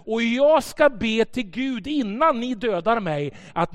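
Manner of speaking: bold defiance. Retold or imitated. Pastor facing armed agents, loud fearless defiance. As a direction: declare it boldly to the gunmen.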